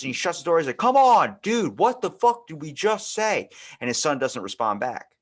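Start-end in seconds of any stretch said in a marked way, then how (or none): 0.8-3.5 loud high scolding, dragged out; exasperated yell. Retold or imitated. Father catching his son again, exasperated loud scolding. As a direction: bark it at him in disbelief.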